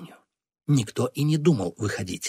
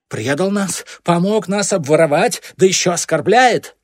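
high, loud, mounting accusation; indignant rage; 7 semitones higher, 7 dB louder than usual. A narrator's reading. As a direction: build to an outraged shout. Enraged accusation in quarrel. (возмущённый крик)

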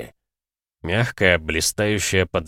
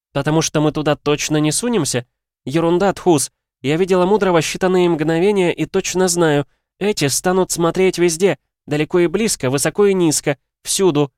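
higher, louder woman's voice; female character voice; 10 semitones higher, 5 dB louder than usual. Acted. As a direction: argue it in a higher pitch.

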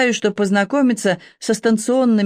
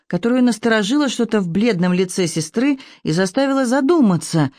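ordinary delivery, nothing marked

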